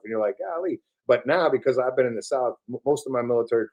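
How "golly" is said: Southern drawl. Retold or imitated. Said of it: "that long drawn out stuff".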